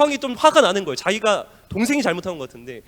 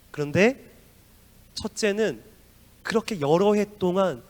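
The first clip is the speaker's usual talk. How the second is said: normal talking voice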